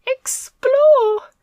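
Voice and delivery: horrified squeak; trembling. A narrator's reading. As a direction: Milo aghast at exploring, trembling squeak. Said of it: "trembled Milo".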